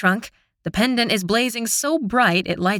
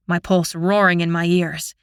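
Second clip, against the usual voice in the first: usual speaking voice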